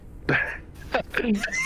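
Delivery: soft, high chuckle; quiet laugh rising in pitch. Real, not acted. Host apologising after the argument, soft high laugh.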